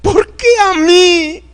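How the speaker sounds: high, slow mock-crying lament, breaking into a sob at the end; mocking Hollywood Abraham wailing over his son. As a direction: ham up a weeping father begging God. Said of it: "No crean que él estaba llorando".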